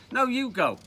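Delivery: put-on high, loud boyish retort; acted squabble. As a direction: insist that your mate goes instead. Boys argue over who goes.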